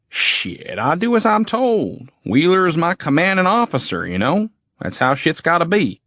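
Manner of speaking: a hint of a sneering backwoods soldier. Goon answers the hero with a sneer.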